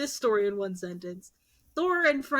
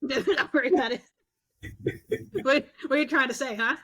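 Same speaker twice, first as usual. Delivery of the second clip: laughing; 4 semitones higher, faster than usual. Real, not acted. Laughing at the joke.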